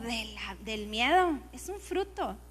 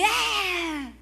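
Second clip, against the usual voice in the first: mock cry of alarm; 4 semitones higher than usual. Sudden outburst when trouble comes.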